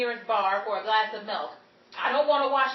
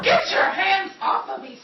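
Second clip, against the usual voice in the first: louder, higher shout to back off; indignant shout; 6 semitones higher, 5 dB louder than usual. Acted. Betty ordering a tipsy man to let go, angry. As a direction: shove him away with your voice.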